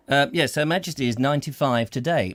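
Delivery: ordinary talk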